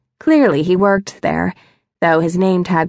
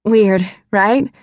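lightly joking question. A narrator's reading. Embarrassed woman showing stranger her letter, jokingly. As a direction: toss it off as a little joke. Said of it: "I said jokingly".

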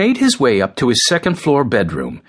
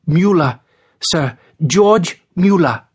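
put-on high-pitched, respectful young prisoner; obedient young man; 6 semitones higher, 3 dB louder than usual. A narrator's reading. George giving his name to the police officer, obedient. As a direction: answer up in pitch, deferential. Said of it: "tried to look like an obedient young man".

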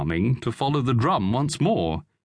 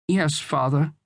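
put-on a touch of dejection, high boy's voice; mildly crestfallen child; disconsolate; 6 semitones higher than usual. A narrator's reading. Son giving in to father, disconsolate. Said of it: "said the boy disconsolately".

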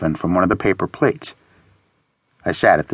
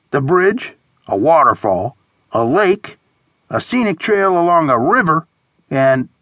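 higher voice, item by item; listing friend; 7 semitones higher than usual. A narrator's reading. Friend reading attractions from phone, listing. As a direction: read off each option, lifting the pitch. Horace reads list aloud.

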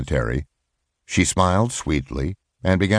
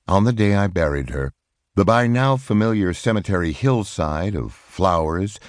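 ordinary delivery

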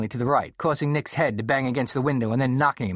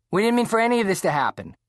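raised, higher outburst; louder than expected; 10 semitones higher, 4 dB louder than usual. A narrator's reading. Nick to the parents, blurting out louder and higher. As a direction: blurt it out too loud. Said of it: "Nick's voice came out louder than he expected".

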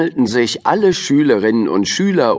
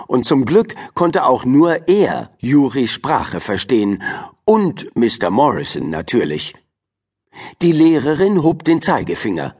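ordinary delivery

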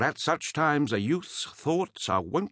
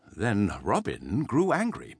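ordinary delivery, nothing marked

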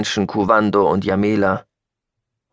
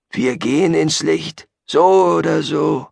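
Gabriel; 8 semitones higher, 4 dB louder than usual. Acted. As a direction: proclaim it with a zealot's certainty. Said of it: "sagte Gabriel". (langsam und hoch, feierlich verkündend)

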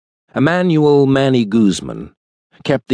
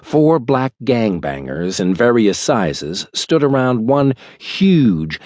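ordinary delivery, nothing marked

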